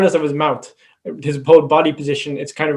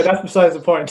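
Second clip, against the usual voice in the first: ordinary delivery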